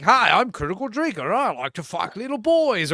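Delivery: lightly voiced Scottish critic introducing himself; mock Critical Drinker. Streamer imitating Critical Drinker in a hypothetical fake video. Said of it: "It'd be one thing if this is like".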